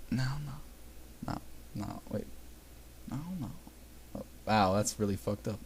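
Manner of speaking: breathy whispered no; whispering. Real, not acted. Whispered aside reacting.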